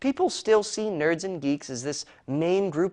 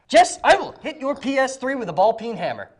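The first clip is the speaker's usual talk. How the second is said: mock threat; 6 semitones higher, 6 dB louder than usual. Threat to a co-host.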